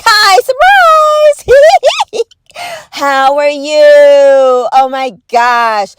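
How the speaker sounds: hammed-up sing-song hello; mock cheerful intruder. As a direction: play a giddy surprise visitor. Mock alien jumping from hatch.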